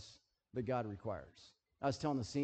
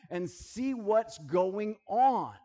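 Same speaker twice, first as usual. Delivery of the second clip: drawn out slowly, higher and louder; slow emphatic urging; 6 semitones higher, 6 dB louder, much slower than usual. Acted. Paul urging the church to look around, slow and emphatic. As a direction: press the words out slowly.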